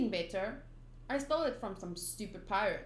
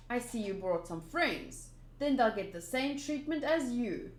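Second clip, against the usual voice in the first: usual speaking voice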